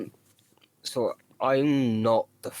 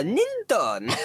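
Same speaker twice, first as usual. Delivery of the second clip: higher put-on foreign accent on Ninton; fake foreign accent; 9 semitones higher than usual. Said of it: "I don't know what even accent I'm doing".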